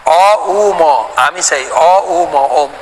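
অ উ ম drawn out very slowly, sound by sound; syllables of om. Shown as a demonstration. Teacher breaking the sacred syllable ওম into its sounds, slow. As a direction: spell out অ উ ম slowly, then join into ওম. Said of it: "কিন্তু ওম".

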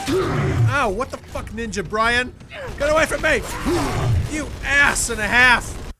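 loud, high furious yells; real pain and rage at the attacker; angry yelling. Real, not acted. Gamer hit by an enemy, furious loud yelling.

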